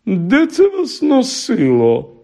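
високий протяглий голос пані; обурено протягни запитання згори вниз; здивована пані. Acted. Пані обурено питає дітей. (манірне здивування пані)

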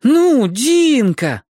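high, loud, long-drawn whine; pleading howl. A narrator's reading. Two boys begging Dinka to tell, in a howling whine. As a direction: wail it, stretching the vowels right out. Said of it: "взвыли Зорка и Лён".

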